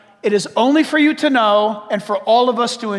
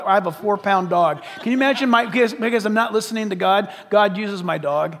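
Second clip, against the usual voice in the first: ordinary talk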